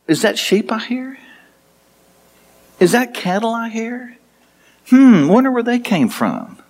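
mock Saul with feigned wondering. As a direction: play innocent while digging it in. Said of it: "he was mocking Saul".

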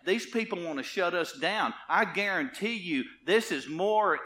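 forceful preaching. Real, not acted. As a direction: warn the congregation with rising force. Preaching building to full force.

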